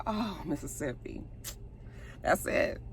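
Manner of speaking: very soft, let-down murmur; hushed disappointment. Retold or imitated. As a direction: say it under your breath, giving up on her. Her own disappointed reaction, retold.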